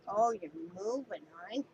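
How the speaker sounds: ordinary delivery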